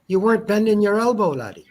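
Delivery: put-on jokey Irish pub accent teasing a friend. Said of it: "I was surprised by your accents too".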